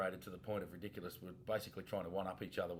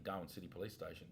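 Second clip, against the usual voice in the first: ordinary talk, nothing marked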